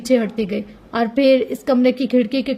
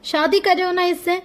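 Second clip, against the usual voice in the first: higher, with a taunting smile; sarcastic smile; 7 semitones higher than usual. A narrator's reading. Wife taunting husband about his marriage, sarcastic smile. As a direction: needle him with a smirk. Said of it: "तंजिया मुस्कुरा कर कहा".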